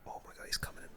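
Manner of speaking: normal talking voice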